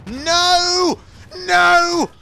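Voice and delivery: very high, loud repeated wail of no; shouting at computer. Real, not acted. Annoyed gamer to the computer, high frustrated shouting. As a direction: yell each one at full pitch. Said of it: "hence why I'm shouting at the computer".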